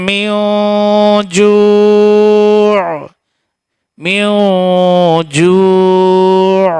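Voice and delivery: slow melodic chant with long held notes; tajweed recitation. Acted. Teacher showing students the right way in melodic recitation. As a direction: chant the verse, stretching the last word.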